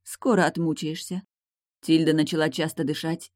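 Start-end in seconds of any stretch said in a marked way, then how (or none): none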